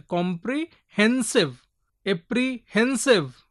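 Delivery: "Comprehensive, apprehensive" slowly, hen stressed in each. Shown as a demonstration. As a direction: draw out both words, leaning on hen. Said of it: "hen is there".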